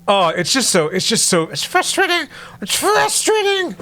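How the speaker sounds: frustration breaking into a high squeak; exasperated squeal. Real, not acted. Ranting about the show.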